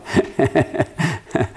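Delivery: a short real chuckle at his own joke; amused chuckle that falls away; real laugh. Real, not acted. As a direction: laugh it off lightly.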